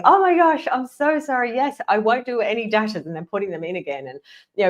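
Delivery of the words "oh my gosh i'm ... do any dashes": high, gushing mock apology, easing down; mock profuse apology. ChatGPT apologizing for putting in dashes, mock gushing apology. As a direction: imitate an over-apologetic chatbot, starting high and loud. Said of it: "basically apologizing to me".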